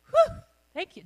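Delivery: ordinary talk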